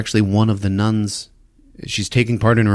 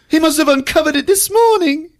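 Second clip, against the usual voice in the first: prim English lady; 4 dB louder, faster than usual. Retold or imitated. Eve telling Hugh what she saw, hammed-up high prim lady. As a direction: play a flustered posh lady. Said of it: "Eve's voice".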